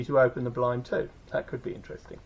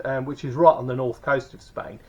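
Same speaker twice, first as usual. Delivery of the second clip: ordinary talk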